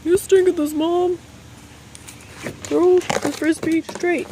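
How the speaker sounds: high, slow cheeky dog voice; dog's voice. Pretend. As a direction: voice the dog scolding mom. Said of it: "He's like".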